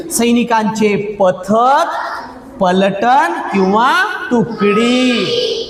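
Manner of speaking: slow, drawn-out model answer; demonstrated answer. Shown as a demonstration. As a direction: stretch each word out slowly for the class to copy. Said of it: "कसं बोलायचे मी बी सांगतो".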